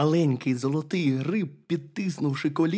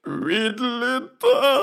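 slow, high bear-cub wail; tearful whimper; 9 semitones higher, 5 dB louder, slower than usual. A narrator's reading. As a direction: whimper it loudly as a bear about to bawl. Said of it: "хлипнув ведмедик і розривівся".

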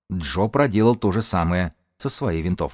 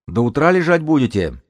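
raised, high taunting call; taunting shout; 7 semitones higher, 5 dB louder than usual. Acted. Young lookout taunts the pinned-down group, calling out. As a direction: call out a jeer across the distance.

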